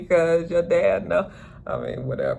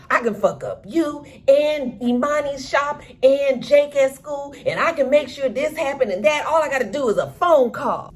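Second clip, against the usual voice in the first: Duda's threat; 11 semitones higher than usual. Retold or imitated. Duda threatening Trig.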